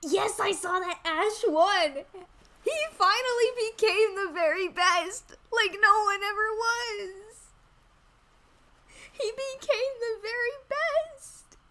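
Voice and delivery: high, slow hammed-up crying; mock sobbing. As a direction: wail it like fake tears. Fake sobbing over Ash winning.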